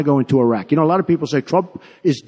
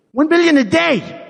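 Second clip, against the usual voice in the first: incredulous outburst; 8 semitones higher than usual. Real, not acted. Outraged about the Saudi oil money.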